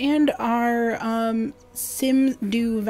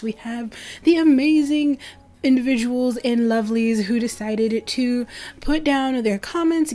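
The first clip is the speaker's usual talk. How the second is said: ordinary delivery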